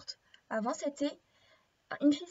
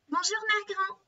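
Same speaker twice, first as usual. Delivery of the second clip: higher, cheery greeting; cheerful little girl; 6 semitones higher, 3 dB louder than usual. Acted. Girl arrives at Grandmother's bedside, cheerful higher voice. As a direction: greet Grandmother brightly, pitched up. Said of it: "fait-elle gaiement".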